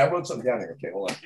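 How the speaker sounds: usual speaking voice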